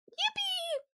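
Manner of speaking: squeaky high mock cheer; a fake, very high hooray; sarcastic yippee. Sarcastic cheer about busy week.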